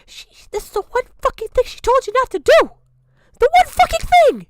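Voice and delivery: high, loud, enraged shouting; furious yell. Real, not acted. Streamer raging at someone who broke a rule, yelling. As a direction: scream it, losing all patience. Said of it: "I'm so angry".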